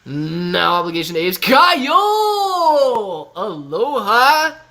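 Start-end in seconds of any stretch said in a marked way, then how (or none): 1.4-3.3 shouting his name with a laugh; big happy yell of greeting; joyful laughing shout; excited shout. Real, not acted. 3.3-4.6 slow, sung-out Aloha, higher; a long happy Hawaiian hello; drawn-out aloha. Real, not acted. Stretched Hawaiian greeting.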